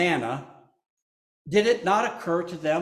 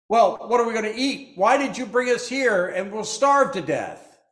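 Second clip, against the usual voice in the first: raised, higher, quick complaint; grumbling crowd; 6 semitones higher, 4 dB louder than usual. Acted. Hungry Israelites complaining to Moses, raised quick whine.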